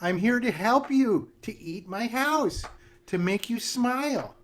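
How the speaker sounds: put-on sugary old witch comforting a child; sweet witch voice.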